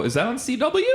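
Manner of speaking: squeaky high question; high pitched. Real, not acted. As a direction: voice jumps way up. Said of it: "I went really high there".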